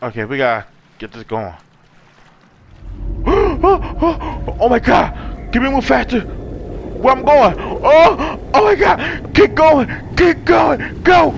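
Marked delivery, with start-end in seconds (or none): 2.8-4.5 high, frightened gulps of air; panicked gasps. Real, not acted. Gamer suddenly chased, panicked gasping. 4.6-11.3 high frantic cries building to screams; panicked yelling. Real, not acted. Gamer fleeing and urging a jump, panic building to screaming.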